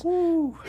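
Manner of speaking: high, loud whistled exhale; whew. Real, not acted. Storyteller after a heavy tale, loud high whew. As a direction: let out a big high whew.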